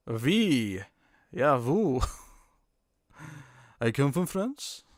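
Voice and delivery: half-hearted mock French accent; bad French accent. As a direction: have a clumsy go at a French accent. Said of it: "I can't do a French accent".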